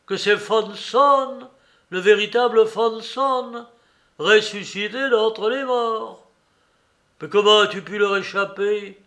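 loud, high, overjoyed outburst; delighted exclaim. A narrator's reading. Father greeting Maximov, loud and delighted. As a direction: cry out, carried away with glee.

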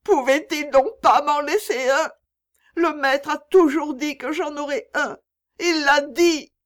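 high, loud, broken-hearted lament. A narrator's reading. Mother left without any child, high broken-voiced lament. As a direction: wail it in a breaking voice. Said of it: "répétait-elle encore et encore d'une voix brisée".

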